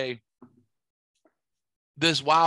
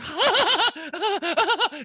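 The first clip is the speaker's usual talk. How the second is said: high-pitched mock cackle; uncontrollable laugh, imitated. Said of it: "just laughs uncontrollably".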